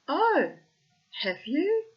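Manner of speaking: high, loud mock surprise; acted, high-pitched "oh really"; arch challenge. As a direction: challenge the threat with a rising question. Mother answers the taunt.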